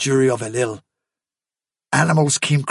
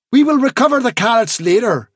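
brisk, higher princely pronouncement; lightly voiced Prince Rainbow; 8 semitones higher, 4 dB louder than usual. A narrator's reading. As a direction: declare it as the prince in charge. Prince Rainbow speaking in the story.

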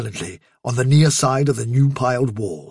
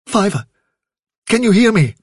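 higher, urgent call to rouse someone; anxious calling; 7 semitones higher, 4 dB louder than usual. A narrator's reading. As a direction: try to get through to an unconscious friend. Rousing an unresponsive friend.

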